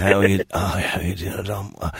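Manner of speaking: weak put-on sick voice. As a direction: feeble fake-ill caller. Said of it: "the sick voice".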